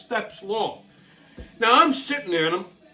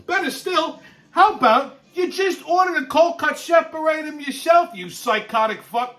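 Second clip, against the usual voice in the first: mock outrage; 9 semitones higher than usual. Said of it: "I said".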